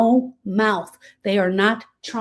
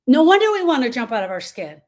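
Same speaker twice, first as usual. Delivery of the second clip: ordinary delivery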